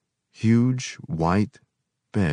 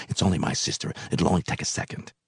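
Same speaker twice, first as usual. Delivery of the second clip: hushed aside; 5 dB quieter, faster than usual. A narrator's reading. Woman whispering behind mouthpiece.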